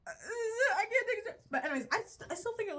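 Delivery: giggling helplessly; laugh-crying. Real, not acted. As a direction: lose it laughing.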